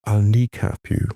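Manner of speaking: slow, measured warning with long pauses; unhurried, weighty imitation of a protective father; menacing voice in the ear. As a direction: deliver a deliberate threat, phrase by phrase. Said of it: "this voice came in my ear".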